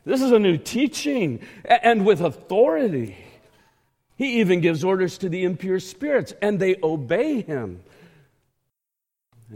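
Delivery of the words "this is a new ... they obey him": high, loud acted astonishment; amazed crowd. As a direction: exclaim it like an astonished onlooker. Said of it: "the people were also amazed".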